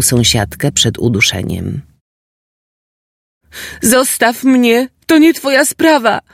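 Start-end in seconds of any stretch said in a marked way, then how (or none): 3.8-6.2 put-on high, desperate girl screaming; angry shout. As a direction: push him away, furious and high. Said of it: "krzyknęła Marysia".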